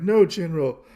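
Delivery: high, loud cry of panic, acted; mock desperate plea. Stranded soldier running after the General, mock desperate plea. Man left behind on the bridge.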